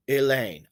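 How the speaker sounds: Elaine with weight on LAINE; light first syllable, strong LAINE in Elaine; stress on second syllable. Shown as a demonstration. Teacher showing the emphasized syllable, second-syllable stress on Elaine. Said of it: "I'm emphasizing the second syllable in the name".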